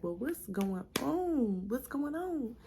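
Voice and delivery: stretched "on" swooping up and down; sing-song questioning. Retold or imitated. Doctor coming in to the puzzled tech, sing-song questioning. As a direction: imitate a doctor's drawn-out, melodic query.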